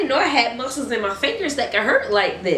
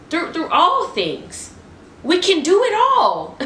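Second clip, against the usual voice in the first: much higher and louder, slowing into a shout of faith; rising excited cry; 11 semitones higher, 4 dB louder than usual. Real, not acted. Preacher rallying viewers that God is with them, soaring excited shout. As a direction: build it up into a joyful cry.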